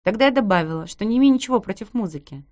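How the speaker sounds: usual speaking voice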